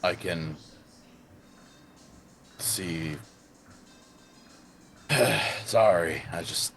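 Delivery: hesitant low character voice; low-voiced game character. Player answering the group in character, low and hesitant. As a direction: speak low, with halting pauses. Plays a character, far below own voice.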